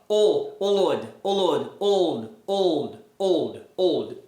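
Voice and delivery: put-on "Olod" slowly squeezed down into "old", low and falling; removing the middle O. Shown as a demonstration. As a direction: slide from "Olod" to "old", keeping the L. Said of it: "Aí você vai tirar o O depois". (Olod encolhendo até old)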